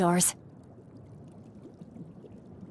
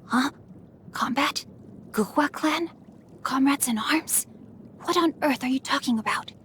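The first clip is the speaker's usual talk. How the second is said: higher, hushed near-whisper; alarmed hush; 4 semitones higher, 5 dB quieter than usual. Acted. Hiding a secret from eavesdroppers, hushed and urgent. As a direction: keep it down, protecting a secret.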